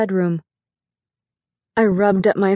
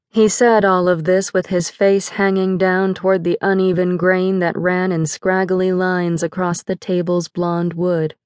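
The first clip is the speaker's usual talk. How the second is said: ordinary delivery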